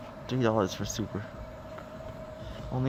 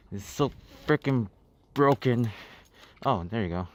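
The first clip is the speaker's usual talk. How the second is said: usual speaking voice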